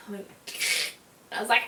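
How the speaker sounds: normal talking voice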